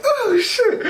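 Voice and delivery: very high, laughing "Oh shit"; amazed laugh. Retold or imitated. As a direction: burst out in laughing disbelief. Surprised he got the number.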